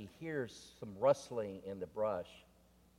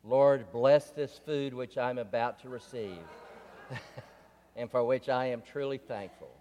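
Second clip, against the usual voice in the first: louder mock-solemn mealtime prayer, a touch of laughter; bear saying grace; 3 semitones higher, 8 dB louder than usual. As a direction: say grace like a hungry bear before dinner. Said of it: "and spoke".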